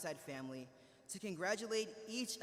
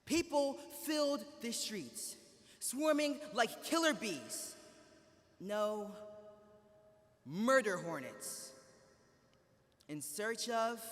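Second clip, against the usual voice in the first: dramatic legend telling; 3 semitones higher than usual. Pretend. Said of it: "Gather around, kids. I have a story for you".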